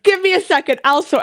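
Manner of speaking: mock-urgent loud cut-in, as if taking charge; retold quick retort. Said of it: "Joey's immediately like".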